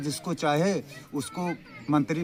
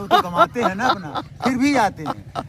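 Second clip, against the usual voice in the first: laughing it out, pitched higher and louder; amused, half-laughing disbelief; laughing in amazement that fans still come; 10 semitones higher, 7 dB louder than usual. Real, not acted. Laughs while praising fans.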